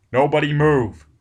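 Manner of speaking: loud, hard order; firm command. Acted. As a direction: stop them dead with the order. Barnes commands the crew.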